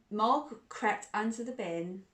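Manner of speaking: ordinary delivery, nothing marked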